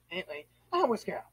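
normal talking voice

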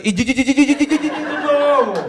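high stammered ідіть, ідіть repeated then додому; stuttering yell. Pretend. Stuttering comic shouts ідіть at invaders, high and fast. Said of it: "А я ще заїка".

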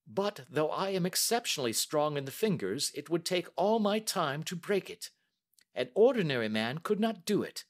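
a brisk, confident Holmes; the detective testing the banker, lightly voiced; Holmes, cool and measured. A narrator's reading. Holmes voiced while reading.

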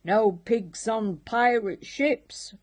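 loud gruff pirate captain growl; captain's hammed-up pirate growl. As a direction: growl it to send him off. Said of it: "growled Captain Wibbly Shins".

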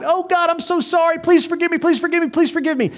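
very high, begging whine; mock pleading. Preacher mocking the imagined sinner's plea, very high. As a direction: plead for forgiveness in a high whimper.

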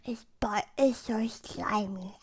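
disgusted hushed whisper, slowed. Real, not acted. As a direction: breathe the words out, barely voiced. Gross slimy bite, to camera.

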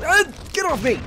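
high-pitched, startled cursing; panicked alarm. Real, not acted. Gamer swarmed by freaking dogs, panicked. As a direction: react in a fluster to an ambush. Dogs attack him in the game.

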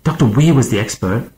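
exasperated, indignant protest; angry. A narrator's reading. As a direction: throw it back heatedly. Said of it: "Stark said angrily".

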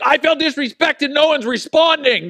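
high, loud sulky complaint; mock indignation. Pastor imitates himself sulking in kitchen, high loud whine. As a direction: whine it like a wounded grump. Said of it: "and I'm like".

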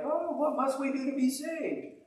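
pleading crowd. Retold or imitated. Crowd pleading after sermon.

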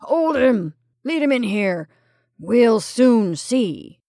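loud commanding orders; crisp directions. A narrator's reading. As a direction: take charge of the capture. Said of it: "gave crisp directions to the young man".

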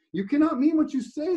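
high, shrill-edged mock shriek. Dangling man shrieking up at the man on the bridge, high panicked cry. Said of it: "the other shrieked".

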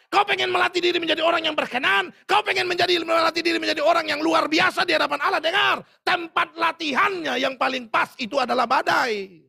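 loud, high preacher's shout; full-voiced sermon climax; preaching at full force. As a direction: thunder it at the congregation. Preaching loudly.

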